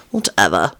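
low, dismissive grumble; irritable. A narrator's reading. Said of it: "Lupin said irritably".

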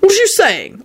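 high-pitched heated demand; angry snap. A narrator's reading. Arthur challenging Kingsley's insinuation, angry. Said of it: "Arthur snapped out angrily".

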